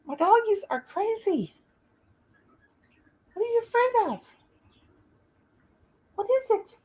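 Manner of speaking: high sing-song voice for a scared dog; pet talk. Real, not acted. Coaxing scared dogs.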